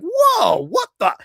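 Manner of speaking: high-pitched retold protest; acted-out outrage, voice jumping up; indignant argument. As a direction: argue your case in rising disbelief.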